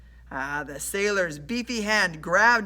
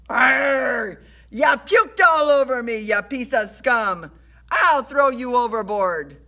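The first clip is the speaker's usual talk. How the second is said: loud, raised roar of outrage; enraged sailor; 5 semitones higher, 8 dB louder than usual. A narrator's reading. Sailor covered in vomit threatens Rush, furious shout.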